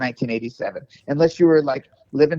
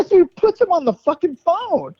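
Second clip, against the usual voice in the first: ordinary talk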